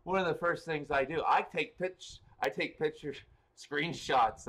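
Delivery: fired up telling her trick; excited. Real, not acted. Energized aside about screenshots.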